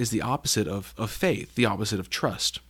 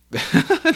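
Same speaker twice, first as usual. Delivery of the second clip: high laugh; 5 dB louder than usual. Real, not acted. Laughing at ghost scare.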